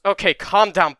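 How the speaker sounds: loud, firm call to stop. Acted. Doctor loudly stopping Izuku and Bakugo fighting. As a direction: cut in over the shouting.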